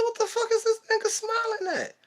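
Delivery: very high, strained mock disbelief; incredulous outburst. Seeing the weak friend smile, high incredulous outburst. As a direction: squeak out your outrage at the grinning friend. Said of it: "I said".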